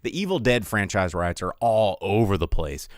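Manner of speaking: usual speaking voice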